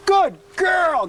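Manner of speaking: high, loud, gushing dog praise; big praise. Shown as a demonstration. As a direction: praise her with real enthusiasm. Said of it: "Give her a lot of praise".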